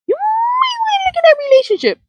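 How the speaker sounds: very high, squeaky aside; comic falsetto hint; playful high-pitched warning. Pretend. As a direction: shoot way up high for mock-delicate advice.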